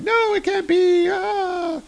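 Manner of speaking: very high, breaking disbelief; distraught Hannah. Acted. Hannah hears Jake is dead, very high and stricken. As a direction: gasp it out in high falsetto shock. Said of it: "and she's like".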